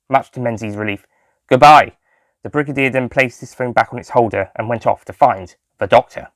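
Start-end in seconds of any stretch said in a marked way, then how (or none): 1.5-1.9 curt goodbye. A narrator's reading. Brigadier ending the call.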